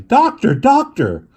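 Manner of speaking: very high, loud call; shouting. Acted. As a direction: shout for the doctor.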